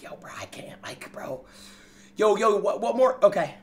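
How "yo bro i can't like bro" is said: whispering the chat's reaction; hushed unvoiced mock whisper. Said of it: "y'all are like".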